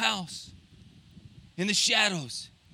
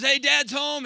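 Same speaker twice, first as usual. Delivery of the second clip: bright, raised call to the family; cheerful homecoming call; 6 semitones higher, 5 dB louder, slower than usual. Acted. Father greeting his kids as he walks in, cheerful call. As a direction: announce yourself happily at the door.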